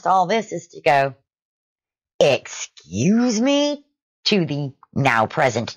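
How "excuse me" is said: high, very drawn-out retort; indignant. Retold or imitated. Shopper answering the screaming son, drawn-out indignation. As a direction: stretch it out in mock affront.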